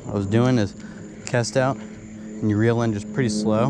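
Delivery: ordinary delivery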